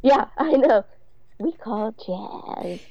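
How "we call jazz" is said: much softer, very slow mock drawl; mock voiceover. Mocking Carrie's voiceover with a lisp-like drawl. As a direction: drag out the words softly, mocking. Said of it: "you sound like you have a fucking speech impediment".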